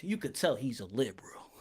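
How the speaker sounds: hushed, breathy aside; whispered joke. Real, not acted. Streamer whispering a jab about the fighter, sly whisper.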